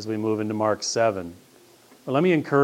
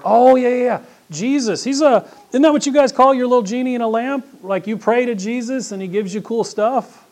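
much higher, louder, a sneering caricature of a casual believer; mocking high voice; 12 semitones higher, 7 dB louder than usual. Pastor mimics a skeptic mocking Jesus as a genie, high mocking voice. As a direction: toss it off like a smug skeptic mocking the faith.

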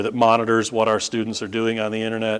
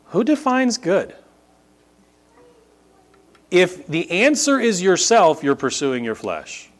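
preaching at full force; 8 semitones higher, 4 dB louder than usual. Acted.

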